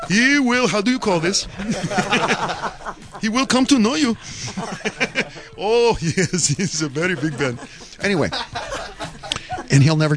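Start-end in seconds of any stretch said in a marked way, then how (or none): 0.1-8.3 mock Indian accent, mostly pitched up; comic Indian driver hunting for words. Taxi driver talking up his friend Animal, Indian accent. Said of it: "You went to India".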